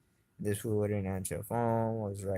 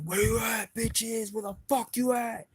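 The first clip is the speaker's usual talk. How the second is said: high-pitched challenging holler; calling them out; 12 semitones higher than usual. Retold or imitated. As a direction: call out hidden enemies, pitch way up. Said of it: "I would always call 'em out".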